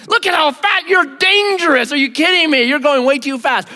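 high, loud, mock-outraged complaint; hammed-up road indignation; mock indignant rant. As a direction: rant like a self-righteous driver. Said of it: "What do you think in that moment?".

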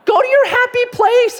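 high cooing voice calming pet turkeys; soothing turkey owner. Retold or imitated. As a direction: coo to the birds in a high soothing voice. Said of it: "she's like the whole time".

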